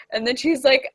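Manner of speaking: plain reading voice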